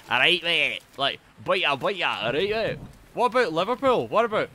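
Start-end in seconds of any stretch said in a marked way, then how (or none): 0.1-2.8 hammed-up Geordie greeting; Geordie accent. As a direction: caricature a Geordie local. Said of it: "no one in Geordieland speaks like".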